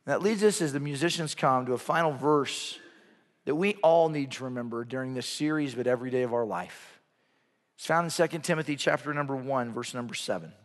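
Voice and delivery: low, hushed preaching with weighty pauses; solemn sermon close. Real, not acted. Preacher reading a final verse as musicians come, solemn hush. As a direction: bring it down low, phrase by phrase. Closing scripture and solemn appeal.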